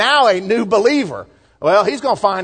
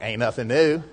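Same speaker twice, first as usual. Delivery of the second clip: a quiet Southern aside, ain't rolled lazily; Southern talk; 10 semitones lower, 6 dB quieter than usual. Shown as a demonstration. Pastor drops softly into his Southern talk for the congregation. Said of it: "And that's my Southern talk".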